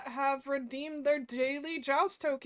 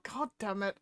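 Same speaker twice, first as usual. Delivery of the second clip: ordinary talk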